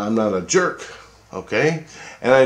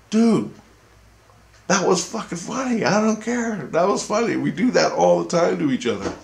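retold protest; 9 semitones higher than usual. Retold protest to an upset Christ.

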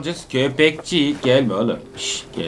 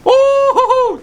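surprised; 6 dB louder than usual. Real, not acted. Surprise in chase.

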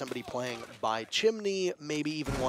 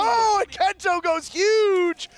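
huge drawn-out hype yell, much higher and louder; excited call; 8 dB louder, slower than usual. Real, not acted. Caster erupting as Kento gets the kill. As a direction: scream the big play call.

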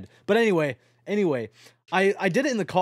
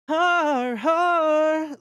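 1 sarcastic har har; 10 semitones higher, 4 dB louder than usual. Pretend. Mock pirate laugh to chat.

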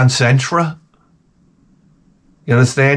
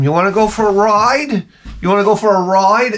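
high, sing-song talk to a dog; a high excited dog-owner voice; baby talk to the dog; 8 semitones higher than usual. Retold or imitated. Talking to his dog.

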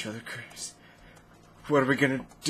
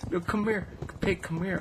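high sing-song call to a pig; coaxing a pig; 9 semitones higher than usual. Pretend. Player calling a pig to come closer, high coaxing. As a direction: coax the piggy over, pitched up.

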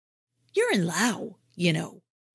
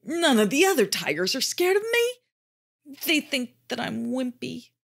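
sad; 6 semitones higher than usual. A narrator's reading. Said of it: "said the tiger sadly".

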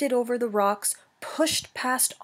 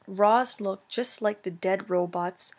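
plain reading voice

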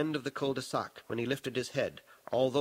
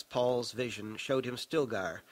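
ordinary delivery

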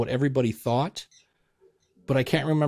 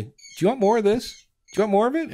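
eager diner; 8 semitones higher, faster than usual. Pretend. Imagined diner asking for more.

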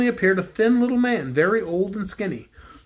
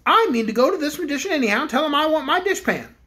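higher, quick determined voice; determined cook; 7 semitones higher than usual. Acted. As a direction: set your mind to go. Cook speaks.

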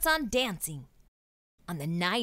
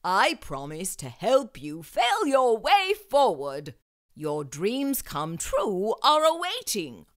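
slow, louder headmaster voice; kindly headmaster; 4 dB louder, slower than usual. Acted. Headmaster welcoming the students, slow and grand. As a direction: speak like a grand, encouraging headmaster.

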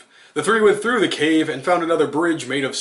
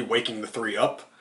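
usual speaking voice

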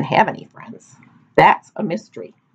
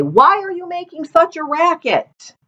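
annoyed villagers; 12 semitones higher than usual. A narrator's reading. Woken villagers complaining.

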